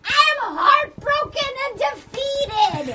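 high, slow theatrical wail; mock heartbreak. Answering through the closed door, mock heartbroken wail. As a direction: draw it out as a hammed-up lament.

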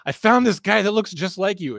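put-on excited drunk guy. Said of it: "And he goes".

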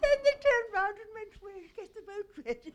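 talking through helpless laughter; laughing. Real, not acted. Storyteller recalling feeling foolish: laughing through the words.

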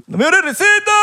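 high, far-off hyped shout of the catchphrase. Retold or imitated. Host announcing the guest's famous catchphrase, hyped high shout. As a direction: belt out the catchphrase like a hype man.

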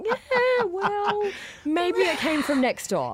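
high, drawn-out mock nonchalance; playful shrug-off. Guest joking she'd excuse a roach in good food, playful shrug-off. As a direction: wave it away in a high, slow lilt. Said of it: "I'd just be like".

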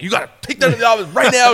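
high, rushed boss's command; urgent order. Retold or imitated. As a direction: imitate an excited boss hurrying someone. Boss urgently orders him back.

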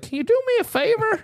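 put-on high, wheedling old man voice; high-pitched old neighbor impression. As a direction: imitate an old man asking sweetly, way up high. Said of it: "a nice old man or whatever".

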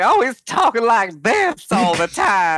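high, slow, drawled character voice; exaggerated, languid high-pitched crime boss; Zero the Hutt. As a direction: draw out every word in a high put-on voice. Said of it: "do a good Zero impression".

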